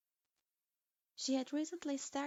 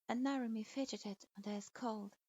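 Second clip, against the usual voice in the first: ordinary delivery, nothing marked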